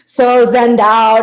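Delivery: usual speaking voice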